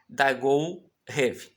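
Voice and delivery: Dagou Rev drawn out very slowly, syllable by syllable; slow demo reading. Shown as a demonstration. Teacher slowly reading Dagou Rev to students. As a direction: stretch Dagou Rev out for learners. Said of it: "Então vai ser assim".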